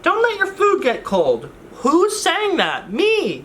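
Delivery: nagging high voice about the food. Pretend. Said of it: "You can talk to yourself?".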